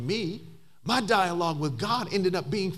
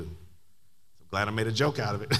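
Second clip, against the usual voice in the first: ordinary talk, nothing marked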